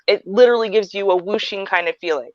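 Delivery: usual speaking voice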